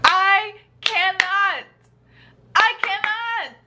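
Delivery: usual speaking voice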